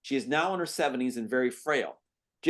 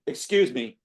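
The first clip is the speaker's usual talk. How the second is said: curt, annoyed nurse; a stiff, irritated interruption; 5 dB louder, slower than usual. Acted. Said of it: "Nurse irritated".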